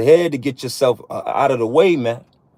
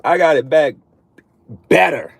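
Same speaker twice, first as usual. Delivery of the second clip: normal talking voice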